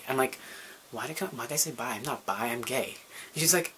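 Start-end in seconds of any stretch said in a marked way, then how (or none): 0.9-3.0 quick, soft panicked self-correction; flustered mutter. Retold or imitated. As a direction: rush it under your breath, embarrassed.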